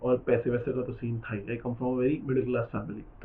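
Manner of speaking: ordinary delivery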